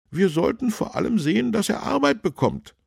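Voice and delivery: high, loud, brisk councillor; councillor's suggestion. A narrator's reading. Councillor proposes a plan to policeman, high and brisk. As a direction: propose it briskly, pitched well up. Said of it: "schlug der Stadtrat vor".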